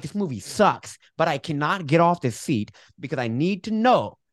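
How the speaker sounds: high, loud exasperated complaint; exasperated but hooked. Retold or imitated. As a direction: whine it, annoyed yet gripped by the story. Retold inner voice at movie.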